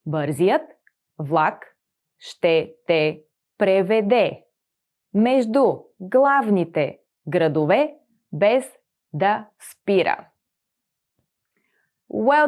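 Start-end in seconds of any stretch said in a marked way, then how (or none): 0.1-10.3 slower, in chunks with pauses; slow model sentence. Shown as a demonstration. Teacher slowing the sentence for the class. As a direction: read it slowly for learners to repeat. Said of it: "slowed sentence for repeating".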